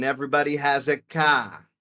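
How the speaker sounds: Boston accent, dropping the R in "car". Shown as a demonstration. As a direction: say "car" as "cah", Boston style. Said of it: "then you go to Boston".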